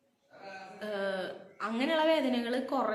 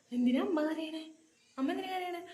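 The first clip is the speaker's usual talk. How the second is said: put-on anxious child; 6 semitones higher than usual. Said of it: "അപ്പോൾ എനിക്ക് ഈ tension കൂടി".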